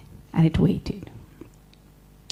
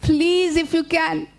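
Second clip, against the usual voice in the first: high little spider plea; pleading Anansi; 8 semitones higher, slower than usual. Acted. Anansi begs rabbit to count the hills, high pleading. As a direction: beg politely in a high small voice.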